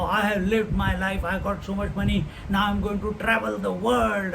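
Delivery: ordinary talk, nothing marked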